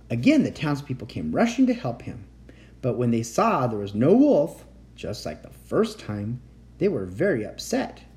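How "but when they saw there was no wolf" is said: ordinary delivery, nothing marked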